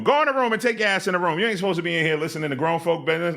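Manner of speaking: put-on high, fast, bossy parent voice kicking kids out; mock parent shout. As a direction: shoo the kid out like an irritated mom.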